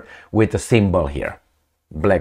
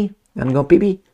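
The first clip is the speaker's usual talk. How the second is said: put-on baby talk; 9 semitones higher, faster than usual. Shown as a demonstration. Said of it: "talk with them".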